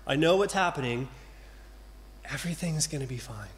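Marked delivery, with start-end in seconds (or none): 2.2-3.5 softer, quick soothing promise; soft reassurance. Acted. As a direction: hush the worry gently.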